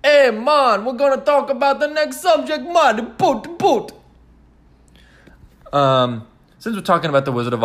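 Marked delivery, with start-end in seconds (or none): 0.0-3.9 loud, high-pitched put-on Boston accent; hammed-up, badly faked Boston accent; mock Boston accent. Shown as a demonstration. Announcing the next subject to viewers, mock Boston accent. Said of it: "And this is Boston".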